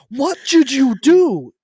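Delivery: loud, high, slow demand; exasperated. Retold or imitated. A son demands answers from his companion, loud and slow. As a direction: hit it loud and slow, can't believe it. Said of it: "I was like".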